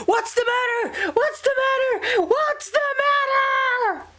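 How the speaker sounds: very high, squeaky panicked shout; jolted awake and panicking; father leaping out of bed in alarm; startled father yelling. Acted. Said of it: "His father jumped out of bed and yelled".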